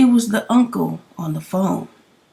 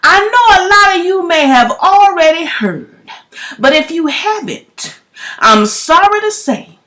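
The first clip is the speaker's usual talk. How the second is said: reading the message; 8 semitones higher, 3 dB louder than usual. Retold or imitated. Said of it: "It says".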